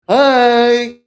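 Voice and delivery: loud, high, goofy "Hi"; a deliberately silly hi; guy greeting a girl in full silly mode. Retold or imitated.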